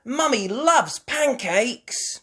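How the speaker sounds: put-on high, loud, slow little girl; Peppa's voice. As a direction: sing it out sweetly.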